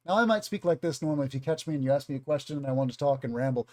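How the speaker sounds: fast, casual ramble; normal rambling pace. Shown as a demonstration. Teacher showing students everyday talking speed, fast rambling. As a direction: rattle it off conversationally. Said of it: "I might talk like that".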